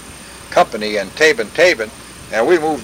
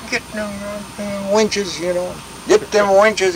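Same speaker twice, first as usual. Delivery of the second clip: higher-pitched call, no real volume; retold holler; 6 semitones higher, 3 dB quieter than usual. Officer calls for winches, half-hearted holler. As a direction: imitate hollering an order across to a crew. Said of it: "he, he hollered".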